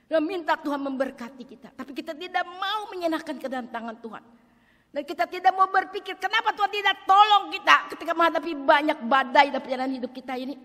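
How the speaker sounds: high, loud preaching; full-force preaching. Acted. Preacher challenges the church about God's help, loud. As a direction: rouse the congregation.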